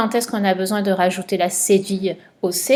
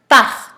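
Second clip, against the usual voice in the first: part said higher and much louder; stress on part; 4 semitones higher, 7 dB louder than usual. Shown as a demonstration. Teacher giving the verb answer to a pupil, punched. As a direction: hit the word part. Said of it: "il fallait souligner".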